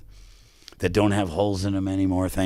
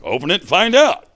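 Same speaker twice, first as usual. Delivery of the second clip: higher and louder, eager push; animated urging; 10 semitones higher, 7 dB louder than usual. Retold or imitated. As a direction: egg him on to open it. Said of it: "Earl's very animated".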